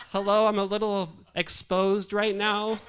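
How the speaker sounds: high, loud, drawn-out complaint; mock nervous protest. Exposed patient reminding the staff he's there, mock nervous. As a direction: call out slowly in hammed-up unease. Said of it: "kind of a little nervous here".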